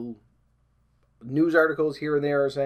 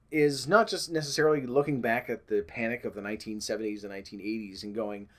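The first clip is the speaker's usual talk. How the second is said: ordinary talk, nothing marked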